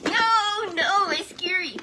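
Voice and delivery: high, loud scared little puppet voice; ventriloquist's dummy, scared and loud. Acted. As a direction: squeak it out like a frightened puppet sidekick.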